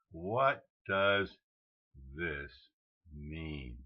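slow, with a pause before THIS and MEAN; word by word. Real, not acted. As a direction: spell out the question one word at a time. Puzzled question spaced word by word.

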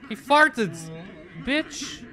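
loud and high, half-laughing disbelief; excited outburst. Real, not acted. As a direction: shout it out amazed at the creature. Excited about creature farting.

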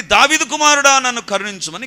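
high, loud beggar's cry; shouting for mercy. Acted. Blind beggar calling to Jesus for mercy, shouting. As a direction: holler out to be noticed and pitied. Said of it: "కేకలేశాడు".

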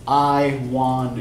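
I given weight, slow; stress on I. Shown as a demonstration. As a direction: lean on I before wander. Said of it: "I should be stressed".

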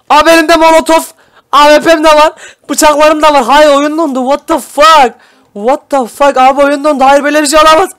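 high, loud panicked complaining; game froze, shouting in disbelief; frantic disbelief. Real, not acted. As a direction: freak out as the game freezes. Game froze mid-win.

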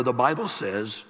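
ordinary talk, nothing marked

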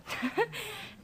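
embarrassed laugh. Real, not acted. Laughs after "I met a guy.

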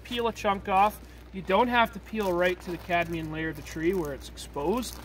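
usual speaking voice

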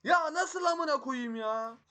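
slow, high, loud cry of disbelief; outraged yell. Real, not acted. Gamer cursing what just happened in the game, outraged drawn-out yell.

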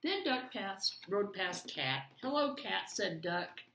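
put-on lively read-aloud for children; story narration. A narrator's reading. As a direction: read it like a bedtime picture book. Reads picture book aloud.

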